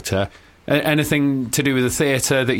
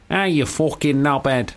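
slow, drawn-out Mancunian; put-on Manchester accent; slower than usual. Shown as a demonstration. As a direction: drawl it like a Mancunian. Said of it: "for Manchester, I would have to go".

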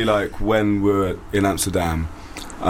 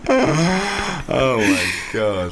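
laughing scream; much slower than usual. Real, not acted. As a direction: burst out laughing at the picture. Reacting to the photo.

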